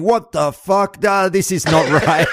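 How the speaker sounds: high mock outrage, half sung; a listener driving along, getting all angry at the call, mock outraged. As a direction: play the riled-up driver yelling at the radio, hammed up. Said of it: "getting all angry, going".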